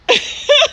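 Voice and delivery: high burst of laughter. Real, not acted. Woman laughing after telling her customer story, high laugh. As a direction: crack up at your own story.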